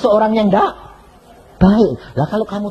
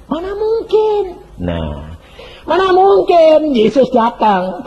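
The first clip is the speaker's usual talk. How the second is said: higher, slower scoffing at the idea; mock scoffing; 12 semitones higher, 3 dB louder, slower than usual. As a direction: drag out the doubter's disbelief. Voicing scoffers' doubt.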